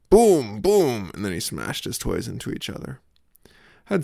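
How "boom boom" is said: put-on toddler crash sound. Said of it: "smashed his toy into the air".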